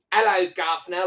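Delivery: high, loud stagey Cockney greeting; mock Cockney accent. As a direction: call it out like a cartoon Londoner. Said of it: "I liked the accent there".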